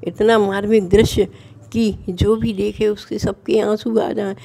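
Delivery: usual speaking voice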